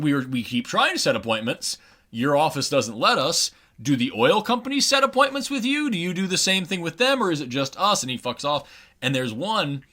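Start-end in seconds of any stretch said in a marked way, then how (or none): 0.0-8.0 higher, rising indignant challenge; frustrated protesters. Retold or imitated. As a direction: press him harder each question. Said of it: "they're like".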